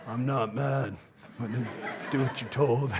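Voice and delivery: gruff Batman growl through a laugh; half-laughing dad in a Batman voice. Retold or imitated. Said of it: "Oh, there's the voice".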